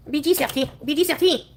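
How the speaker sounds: put-on playful French-accented pet name; exaggerated French accent. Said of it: "exaggerated French accent".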